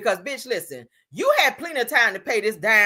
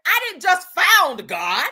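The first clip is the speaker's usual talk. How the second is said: mock Peter; 7 semitones higher than usual. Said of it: "That's what Peter said".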